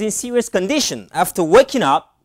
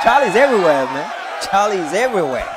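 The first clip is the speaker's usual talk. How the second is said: ordinary talk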